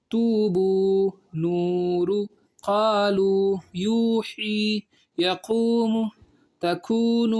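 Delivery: slow Quran-primer recitation with long held vowels; measured teaching recitation, word by word; joined-up recitation. Shown as a demonstration. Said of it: "میں اس کو رواں پڑھ دیتا ہوں پورا".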